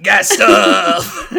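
loud, growly monster truck announcer; Guy Stuff voice. Acted. Son booms a puberty book title as a joke, Guy Stuff voice. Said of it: "how do you do the guy stuff voice".

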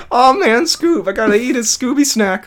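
high, lightly voiced stoner sidekick; Shaggy voice. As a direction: play it like Shaggy. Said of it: "or whatever he says".

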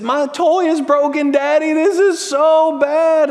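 put-on high, slow child's whine to her daddy; whiny little girl. Daughter telling Daddy her toy broke, high slow whine. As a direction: stretch each word like a sulking four-year-old. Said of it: "that just whiny cry".

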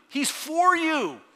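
slow, loud and high on for; a full-voiced preacher's cry, stretched out; preaching shout. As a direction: ring it out to the back row. Pastor building to a climax.

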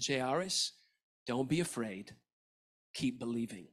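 soft, low and tender; calm a frightened father quietly; Jesus gently comforting Jairus, soft and low; gentle reassurance. Retold or imitated.

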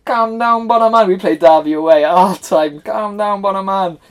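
high-pitched mimicry of a teammate; put-on high, pleading call; Jace impression. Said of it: "so Jace used to kiss his teeth".

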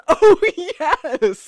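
mock rapturous yes; gleeful exclamation. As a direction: relish it with a laugh. Klaus's delighted exclamation.

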